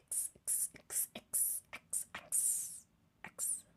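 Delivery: whispered practice of the sound. Shown as a demonstration. Trying out a viewer's name, whispered to herself. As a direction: mouth the letter under your breath.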